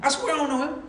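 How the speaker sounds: high-pitched, protesting oath; insistent sworn denial. Acted. Peter pressed about Jesus, insistent sworn denial. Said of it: "With an oath".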